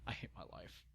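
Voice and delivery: despairing whisper. Real, not acted. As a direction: breathe it out, defeated. Reader despairs at the text.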